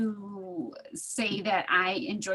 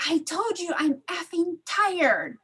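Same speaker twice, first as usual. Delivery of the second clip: retold yell; 7 semitones higher, slower than usual. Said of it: "and he yells".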